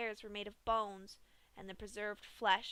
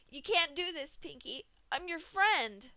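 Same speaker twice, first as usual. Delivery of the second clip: frightened pleading; 7 semitones higher, 5 dB louder than usual. A narrator's reading. Dash pleading for her life.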